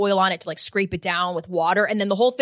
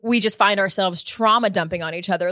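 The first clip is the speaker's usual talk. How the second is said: usual speaking voice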